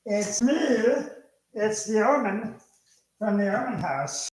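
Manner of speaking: high, drawn-out show-opening hello; high-pitched greeting. Pretend. Show's opening greeting to viewers.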